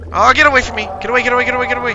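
loud, high frantic shouting; shouting in real panic while running; panicked yelling. Real, not acted. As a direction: yell at the monster to back off. Fleeing a monster in game.

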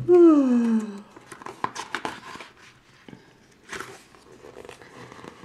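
long tired sigh; sleepy sigh. A narrator's reading.

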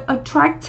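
"attract" with a released, puffed final t; aspirated final consonant. Shown as a demonstration. Teacher showing students the consonant before the ED ending, "attract" with aspirated final t. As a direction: let the last t of "attract" pop softly. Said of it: "âm phụ âm bật hơi".

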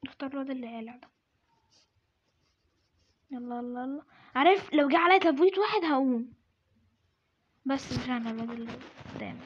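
ordinary talk, nothing marked

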